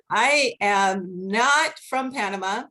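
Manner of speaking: usual speaking voice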